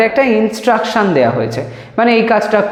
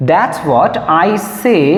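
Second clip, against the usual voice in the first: slow dictation; much slower than usual. Shown as a demonstration. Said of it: "teacher dictates phrase slowly".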